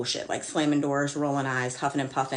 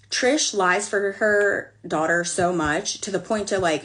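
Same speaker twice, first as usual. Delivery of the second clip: ordinary talk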